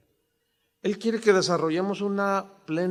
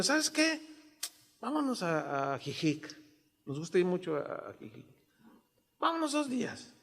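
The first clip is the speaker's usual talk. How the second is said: soft invitation to wife; 8 dB quieter than usual. Retold or imitated. Pastor voicing himself to wife.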